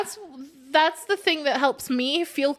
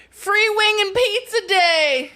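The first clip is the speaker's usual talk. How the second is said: slow, higher promo announcer; mock ad pitch; 7 semitones higher, 4 dB louder, slower than usual. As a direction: announce the deal like a cheesy ad. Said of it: "you end it with ladies".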